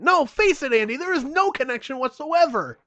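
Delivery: high, loud flat-out contradiction; hammed-up angry rebuttal; exasperated yelling. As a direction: shout him down, fed up. Acted quarrel, exasperated friend.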